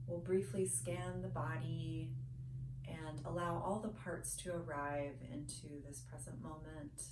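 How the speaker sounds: soft, unhurried meditation guide with long pauses; hushed, soothing body-scan guidance, phrase by phrase; guided meditation. Real, not acted.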